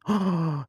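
sharp audible intake of breath; shocked gasp. Real, not acted. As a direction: gasp at a surprise discovery. Finds out author's nationality online.

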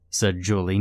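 ordinary delivery, nothing marked

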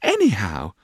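high-pitched "how", dropping to settle it; pretty, airy little answer; Dora. Acted. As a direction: toss it off lightly, starting high. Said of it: "said Dora".

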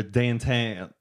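downtown with flattened "dahntahn" vowels; put-on Pennsylvania "downtown"; regional accent. Shown as a demonstration. Host giving weird regional examples, accented downtown. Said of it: "a Pittsburgh kind of accent".

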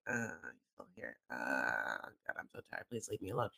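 put-on deep, sleepy, worn-out baby voice; low groggy baby voice; tired baby. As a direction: mumble low like an exhausted baby.

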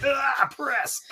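mock straining grunt. [name] lifting Tommy overhead.

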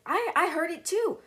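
high, halting, mildly startled voice; mock alarm. Brother tells family he heard it, mock alarm.

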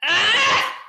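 sharp yelp of pain. Pretend. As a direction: cry out as the shock hits. Hands burning with electricity.

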